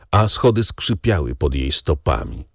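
ordinary delivery

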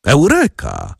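boastful exclamation of success; bold triumphant cry. A narrator's reading. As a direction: crow it out like a victor. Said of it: "krzyknął z niesłychaną brawurą".

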